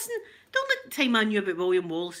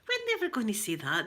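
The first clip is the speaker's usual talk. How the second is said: high-pitched mock-puzzled what; incredulous retold aside; baffled reaction; 8 semitones higher, 3 dB quieter than usual. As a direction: react in bewilderment to odd phrases. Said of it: "you were like".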